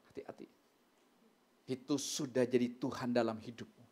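normal talking voice

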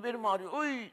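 hammed-up back pain wail; mock groan. Mother groaning about her back.

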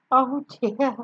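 plain reading voice

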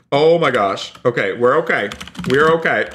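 normal talking voice

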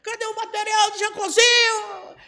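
high, shouted demand; imitated loud demand. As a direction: holler for the missing kit. Imitates reporter demanding kit loudly. (cobrança imitada aos gritos)

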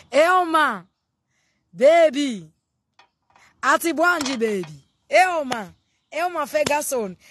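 loud, high call across the room. Real, not acted. Greeting her friend Elma on camera, loud high call. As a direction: hail a friend from afar.

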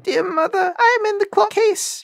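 put-on very high, tiny kid voice; soft little voice. A narrator's reading. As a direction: call to mother as the littlest kid. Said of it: "a soft voice cried".

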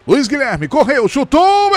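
rising fast toward the goal; building excitement. Real, not acted. Penalty taken, rising to goal.

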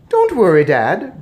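high, loud squeaky reassurance; mouse boy. A narrator's reading. Mouse boy reassures shocked father, squeaky. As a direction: pipe it up to Dad.

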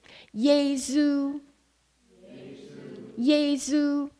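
Yesu said slowly as yay-zoo; Chinese Yesu. Shown as a demonstration. Missionary teaching the congregation Yesu, slowly and clearly. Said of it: "please repeat after me".

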